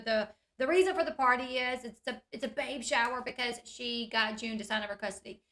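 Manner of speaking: ordinary delivery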